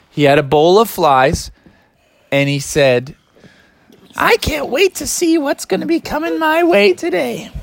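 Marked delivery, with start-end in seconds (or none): 4.2-7.5 high cartoon frog voice; a cheery little frog looking forward to his day; high, eager frog voice greeting the morning; Froggy's voice. Frog voiced in kids' story.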